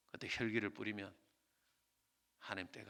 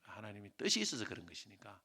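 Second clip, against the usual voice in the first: soft closing exhortation; 4 semitones lower, 7 dB quieter than usual. Acted. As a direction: gently urge them to wait in faith.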